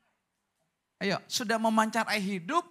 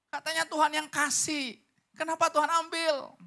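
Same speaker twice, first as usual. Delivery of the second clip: complaining; 5 semitones higher than usual. Retold or imitated. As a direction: play a sulky believer pleading with God. Acts complaining believer to God. (mengeluh kepada Tuhan dengan nada tinggi)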